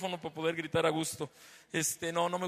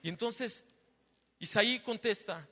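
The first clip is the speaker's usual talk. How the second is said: usual speaking voice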